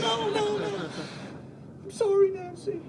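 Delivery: high, whimpering begging voice; panicked pleading. Retold or imitated. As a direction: plead for your life, terrified. Begging Nancy Reagan again.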